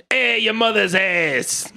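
put-on high, drawn-out Brooklyn accent; Brooklyn heckle. Brooklyn snake insulting a passerby, hammed-up Brooklyn accent. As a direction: heckle like a comic Brooklyn local. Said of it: "that's just what Brooklyn people say".

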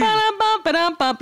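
high vocal drum sting; mock rimshot. Podcast co-host punctuating a joke, mock rimshot. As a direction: sing out a comic drum tag.

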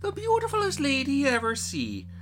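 high, loud gushing maid; excited maid. Acted. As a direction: maids gossiping about the lady. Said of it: "said she".

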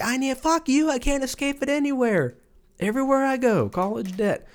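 high-pitched mock anguish; exasperated debtor. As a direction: wail it like a hammed-up victim of debt. Acting out exasperated indebted listeners.

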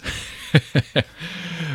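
bright giggling laugh; high laugh. Real, not acted. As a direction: giggle at your own joke. Laughs after the bit.

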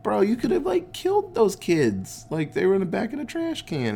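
mock scolding sheriff. Sheriff scolding fired Ernest.

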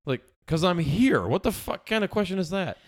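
exasperated, emphatic protest; comic indignation. Retold or imitated. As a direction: rant at an absurd question. Indignant retort to woman.

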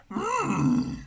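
high croaky Yoda with hammed-up wails; mock Yoda moaning for drink; Yoda impression. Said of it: "fun to talk like Yoda".

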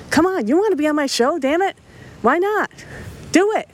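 playful pushy pep; excited urging. Real, not acted. Host eggs on bands to submit.

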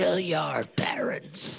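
slow devilish whisper in the ear. Pretend. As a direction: whisper it like a tempter. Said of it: "whispering sweet nothings to him".

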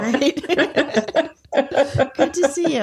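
laughing it out, pitch up high; words bubbling through real laughter. Real, not acted. Laughing at the good phrase.

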